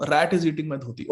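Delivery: ordinary delivery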